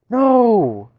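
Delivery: high, loud desperate no; shouted no. Real, not acted. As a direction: yell it as everything fails. Losing in game.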